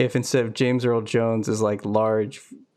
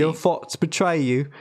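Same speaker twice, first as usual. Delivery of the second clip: higher, mock Cockney villain; Cockney Darth Vader; 4 semitones higher than usual. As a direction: put a Cockney accent on Vader's line. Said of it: "Darth Vader with a Cockney accent".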